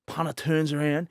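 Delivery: ordinary talk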